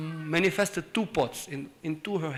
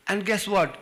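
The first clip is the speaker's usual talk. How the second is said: usual speaking voice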